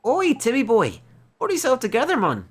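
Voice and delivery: gruff local man. Acted. Neighbour hails Ollie boy from across the street, loud and chummy. Townsman calls the fat cat.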